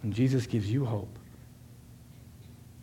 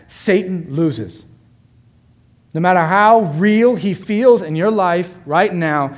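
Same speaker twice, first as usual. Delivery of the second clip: loud, raised, emphatic preacher; full-force preaching; 5 semitones higher, 8 dB louder than usual. Real, not acted. Preacher promising the congregation suffering is short, full-force preaching. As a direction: drive each phrase home to the congregation.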